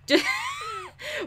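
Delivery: very high, squealing laugh; helpless high cackle; high-pitched laugh. Real, not acted. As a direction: burst out in a shrieky giggle. Cracking up at bad muffins.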